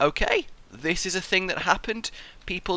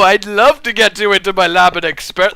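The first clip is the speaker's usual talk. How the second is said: louder gloating scientist voice; hammed-up mad scientist; 9 dB louder than usual. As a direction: relish the threat theatrically. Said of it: "what is wrong with me?".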